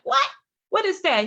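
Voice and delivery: high-pitched acted confusion; quick, startled questioning; bewildered. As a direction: react as if the word makes no sense. Acts out her confusion at unknown term.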